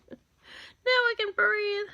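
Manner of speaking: very high, drawn-out squeal of relief; exaggerated relief. Makeup artist finishes a careful line after holding breath, exaggerated high relief.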